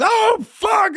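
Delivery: very high panicked "oh, fuck!"; the buddy sees the wound and freaks out. Retold or imitated. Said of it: "and he goes".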